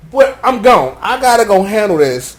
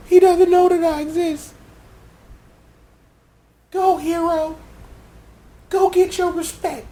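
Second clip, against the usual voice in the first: raised fangirl voice; 7 semitones higher than usual. Acted. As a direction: swoon over him in a high voice. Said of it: "raise it up a couple of octaves".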